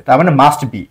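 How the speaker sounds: ordinary talk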